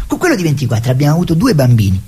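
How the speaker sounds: normal talking voice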